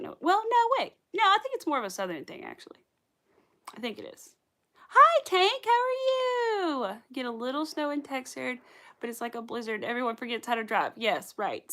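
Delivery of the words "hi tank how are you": high, loud, very slow singsong hello; excited greeting. Real, not acted. Streamer welcoming a viewer, sing-song and loud. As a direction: stretch out the welcome with delight.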